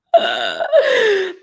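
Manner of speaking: a real burst of high laughter; amused laugh. Real, not acted. Laughing at her own line to her team. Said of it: "That's funny".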